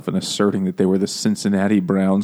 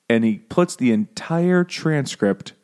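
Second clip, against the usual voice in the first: normal talking voice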